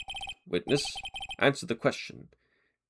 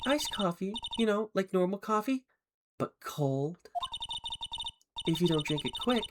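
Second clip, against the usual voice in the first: lightly high, coy witness voice; April May; 7 semitones higher, 5 dB quieter than usual. Acted. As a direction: act coy and innocent.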